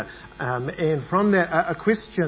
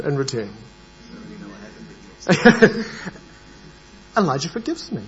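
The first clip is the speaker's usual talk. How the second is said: normal talking voice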